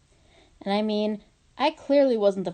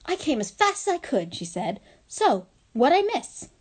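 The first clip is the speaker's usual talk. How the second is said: put-on eager girl; 6 semitones higher than usual. A narrator's reading. Cheerful student bursting in.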